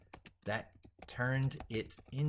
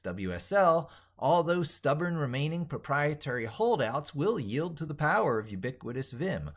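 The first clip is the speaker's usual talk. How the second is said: grandiose proclamation; 5 semitones higher, 4 dB louder than usual. Acted. Grandiose manifesto read for video.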